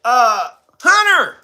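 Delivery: high, loud heckling reporter call; mock reporter yelling out a question; yelled reporter question. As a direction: shout it down the hallway at Hunter. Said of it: "the reporter yelled out".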